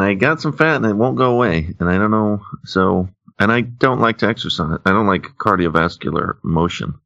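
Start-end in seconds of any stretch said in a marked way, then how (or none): none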